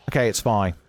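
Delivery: normal talking voice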